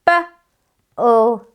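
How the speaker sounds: B-O-O sounded out slowly, letter by letter; letter-by-letter sounding. Shown as a demonstration. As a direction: spell out each sound clearly for children. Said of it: "My turn again".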